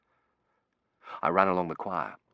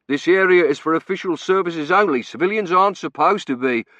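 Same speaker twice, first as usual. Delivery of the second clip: loud, raised official lecture; stern warden; 10 semitones higher, 11 dB louder than usual. A narrator's reading. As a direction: lay down the rules over the noise. Warden lectures civilians over din.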